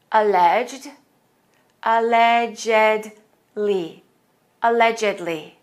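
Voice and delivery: Alleged one beat, allegedly al-LEDGE-ed-ly; repeat model. Shown as a demonstration. Teacher repeating words so students can copy. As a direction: model each word slowly for repeating. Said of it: "Listen again".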